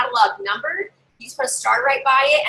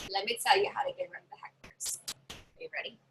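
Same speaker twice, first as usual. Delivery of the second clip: conspiratorial whisper; 12 dB quieter than usual. Real, not acted. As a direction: whisper it like a secret. Teasing a secret to viewers.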